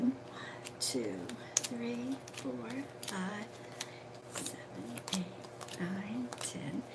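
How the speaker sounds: barely audible murmured count; counting under breath. Real, not acted. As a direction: count the cards to yourself in a whisper. Counting cards under her breath.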